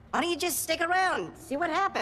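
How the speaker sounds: high, loud plea; loud, high-pitched coaxing; exasperated urging. Acted. Urging the ranting friend to stay.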